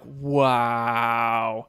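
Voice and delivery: long, low drawn-out wow; jaw-dropped awe. Retold or imitated. Host recalling watching the eclipse stream, awestruck. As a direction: stretch it out slowly in retold amazement. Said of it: "my jaw was down. I was like".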